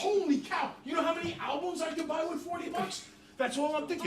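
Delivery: bursting with excitement; giddy shout. Retold or imitated. Kid picturing forty bucks of albums, shouting happily.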